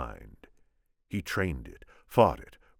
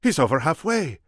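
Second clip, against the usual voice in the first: high, loud character voice; urgent lookout report as the Spaniard; 9 semitones higher, 7 dB louder than usual. A narrator's reading. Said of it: "the Spaniard said".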